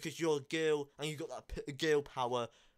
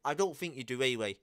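ordinary delivery

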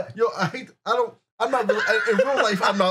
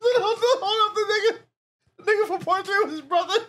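higher, laughing half-cry of realization; laughing discovery; 12 semitones higher than usual. Real, not acted. Host laughing to his cohost as he realizes the villains are brothers, much higher. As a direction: crack up as it dawns on you.

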